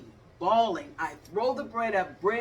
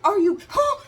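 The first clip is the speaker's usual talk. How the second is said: high, loud startled yelp; startled disbelief; 11 dB louder than usual. Retold or imitated. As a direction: caught off guard by a charging skunk. Reacting to charging skunk.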